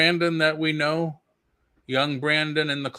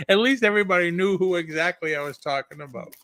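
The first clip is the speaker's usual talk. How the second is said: laughing; 4 semitones higher than usual. Real, not acted. Laughs at the Brandon joke.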